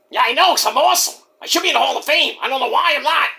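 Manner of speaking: high-pitched boastful character voice; boastful Mike. Acted. Mike agreeing that he's awesome, high boastful whine. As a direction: brag and complain up high.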